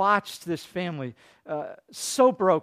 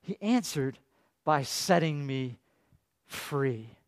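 soft, slow echo; 6 semitones lower, 6 dB quieter, slower than usual. Shown as a demonstration. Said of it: "repeats psalm line".

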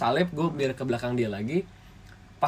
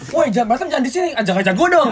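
mock yell; 9 semitones higher, 6 dB louder than usual. Drivers behind yelling at the fight.

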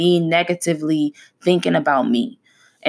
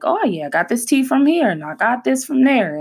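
higher-pitched, lively promotion of others' stuff; upbeat plug; 7 semitones higher than usual. Acted. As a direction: show it off like an eager supporter. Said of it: "and be like".

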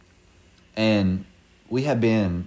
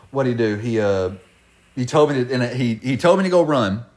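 ordinary talk, nothing marked

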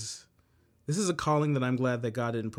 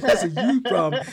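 laughing quote; 5 semitones higher, 8 dB louder than usual. Retold or imitated. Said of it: "He said".

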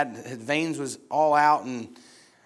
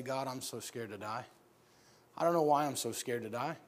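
hushed prayer; 3 semitones lower, 7 dB quieter than usual. Retold or imitated. Child confides fear in prayer.

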